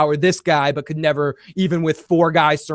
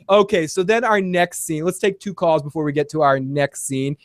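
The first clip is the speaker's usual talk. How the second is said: ordinary talk, nothing marked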